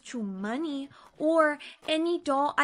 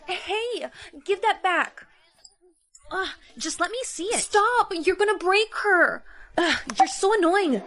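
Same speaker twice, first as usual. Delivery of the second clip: angry yelling; 5 semitones higher than usual. Acted. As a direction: yell to grab it back. Quarrel over the remote.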